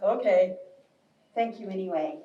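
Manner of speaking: low, subdued bear reply; resigned bear. Acted. Bear giving up on rabbit, low and resigned. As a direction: drop low and let it fade, lightly voiced. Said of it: "And the bear says".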